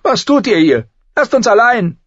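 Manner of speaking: put-on high, loud, furious girl; angry shout. A narrator's reading. Arya telling her sister and prince to leave, angry shout. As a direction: yell at them, very high and indignant. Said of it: "mit Tränen der Wut in den Augen".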